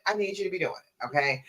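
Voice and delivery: normal talking voice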